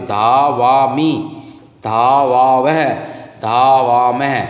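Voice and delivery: धावामि, धावावह, धावामह drawn out slowly with the long आ held; teacher slowly drilling the long आ in धावामि, धावावह, धावामह; slow, stressed long aa. Shown as a demonstration. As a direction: stretch the आ in धावामि, धावावह, धावामह clearly for students. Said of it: "वहाँ पे आ की मात्रा लगाते हुए".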